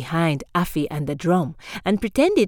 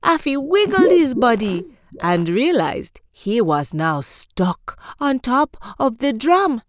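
ordinary delivery, nothing marked

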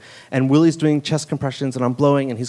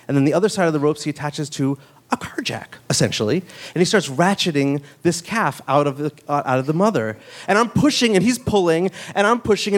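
ordinary talk, nothing marked

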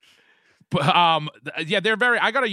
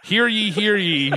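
slow, higher mock proclamation; town crier; 6 semitones higher, slower than usual. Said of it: "ringing it like a town crier".

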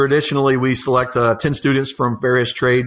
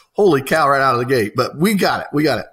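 ordinary talk

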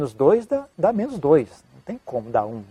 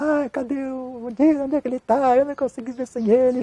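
high, wailing mock-weepy woman's voice; imitated crying woman; 8 semitones higher than usual. Distraught woman on the phone asking for Dias, imitated tearful wail. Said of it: "Era uma mulher chorando, falando".

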